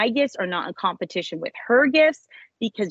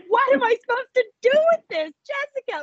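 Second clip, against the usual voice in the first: much higher, bewildered outburst; incredulous high cry. Retold or imitated. Wife tells host her disbelief, bewildered high outburst. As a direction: throw up your hands at the puzzle. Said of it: "It was crazy".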